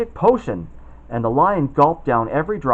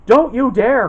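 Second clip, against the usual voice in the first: loud, high protective cry; angry cry; 10 semitones higher, 9 dB louder, slower than usual. Acted. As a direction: shout it to stop the lion. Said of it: "cried Dorothy".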